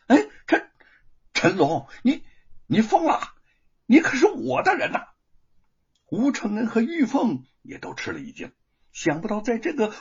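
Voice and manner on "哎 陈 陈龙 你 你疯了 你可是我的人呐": stammering, high and loud astonishment; shocked. A narrator's reading. Magistrate to his rebellious constable, shocked stammer. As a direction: sputter in disbelief at the betrayal. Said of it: "知县大吃一惊".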